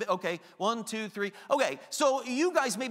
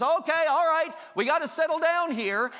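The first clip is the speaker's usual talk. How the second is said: higher and louder, easygoing "settle down"; mock casual calming, pitched up; gentle coaxing Jesus; 7 semitones higher, 6 dB louder than usual. Preacher mocking a gradual, casual calming, higher and louder. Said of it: "Jesus stands up and says".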